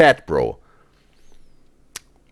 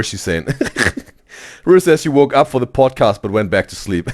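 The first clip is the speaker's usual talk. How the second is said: usual speaking voice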